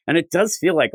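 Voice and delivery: normal talking voice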